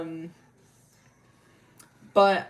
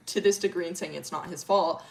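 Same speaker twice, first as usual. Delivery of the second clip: normal talking voice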